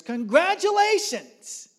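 high, loud, drawn-out shout; ringing, stretched-out cry; jubilant proclamation. Retold or imitated. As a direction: holler it like a big announcement. Voice calling out, waking her.

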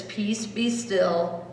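slow and soft; slow reading. Shown as a demonstration. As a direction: read each word slowly. Reading the chapel sign.

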